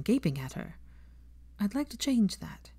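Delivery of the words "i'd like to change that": put-on lightly raised young woman's voice; a hint of earnest Hermione. Hermione confiding in Draco, lightly voiced.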